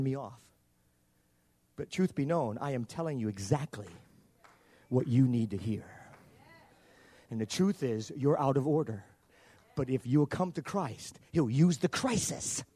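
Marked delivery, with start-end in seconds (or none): none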